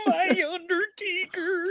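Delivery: high, slow crying wail; Paul Bearer impression. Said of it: "the best voice was Paul Bearer".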